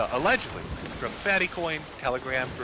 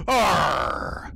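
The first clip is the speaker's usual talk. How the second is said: pirate growl; 3 semitones lower, 4 dB louder than usual. Pretend. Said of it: "you almost sound like a pirate".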